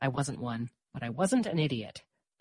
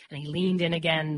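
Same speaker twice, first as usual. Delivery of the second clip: ordinary delivery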